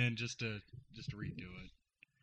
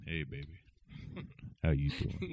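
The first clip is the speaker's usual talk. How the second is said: low, smooth come-on; seductive; 7 semitones lower, 7 dB quieter than usual. Pretend. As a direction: croon it like a flirt. Seductive voice for partner.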